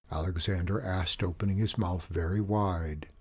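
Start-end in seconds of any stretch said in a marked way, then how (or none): none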